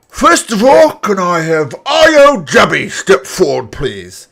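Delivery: put-on booming old orc addressing the crowd; grand proclamation, slow and loud; elderly orc announcer. Orc calls contestants.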